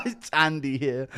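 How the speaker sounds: slow mimicry through laughter; Andy Aspinall impression. Streamer echoing fighter's greeting, laughing impersonation. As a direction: imitate the fighter while cracking up. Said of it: "has heard my Andy Aspinall impression".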